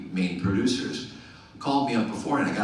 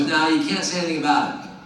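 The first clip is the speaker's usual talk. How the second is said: higher, louder mock Virginia accent; badly imitated Virginia caller; [name]'s terrible Virginia accent; 7 semitones higher, 5 dB louder, faster than usual. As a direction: do a bad Virginia accent, insistent. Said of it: "This is a terrible Virginia accent".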